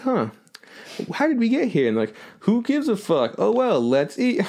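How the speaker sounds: high, goofy, careless cartoon voice; dopey high cartoon kids. Mocking the ending to a friend, high goofy kids. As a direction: play it as clueless cartoon kids.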